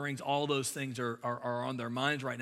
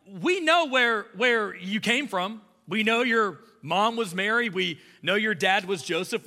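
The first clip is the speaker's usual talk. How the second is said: higher, louder mock crowd taunt; mocking grumblers; 6 semitones higher, 6 dB louder than usual. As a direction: jeer like smug townsfolk. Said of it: "They're kinda like".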